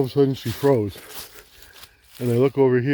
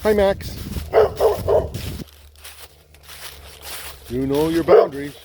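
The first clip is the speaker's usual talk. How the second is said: talking to a dog; 4 semitones higher than usual. Real, not acted. Greeting neighbour's dog.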